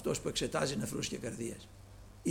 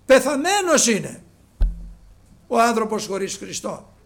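loud, high, fervent preacher voice; preaching at full force; 11 semitones higher, 14 dB louder than usual. Acted. Preacher exhorting the brothers, loud and fervent. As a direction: preach it out to the congregation.

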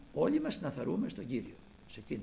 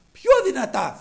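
raised, high emphatic repeat; louder; 8 semitones higher, 14 dB louder than usual. A narrator's reading. Preacher stressing that the blind man shouted more, louder. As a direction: let the voice grow as loud as the words.

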